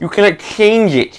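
nerd voice. Pretend. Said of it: "I'm gonna put on the nerd voice for this".

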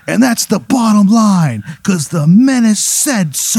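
high, loud, slow wrestler promo; the Menace's catchphrase promo. Acted. Wrestler finishes his promo to the arena, booming catchphrase. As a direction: proclaim it like a wrestling star. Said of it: "like an amalgamation of Ric Flair".

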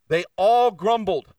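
"all" hit hard and drawn out slowly; preacher underlining the scripture, slow and loud; stress on all. Shown as a demonstration. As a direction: punch the word all in "they all grumbled". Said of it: "It doesn't say that".

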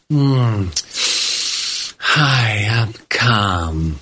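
low, fake-serene voice; forced calm. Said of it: "a forced attitude of".

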